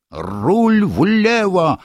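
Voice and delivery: high, very slow hoarse yell. Acted. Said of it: "хрипло заорал Ринсмангл".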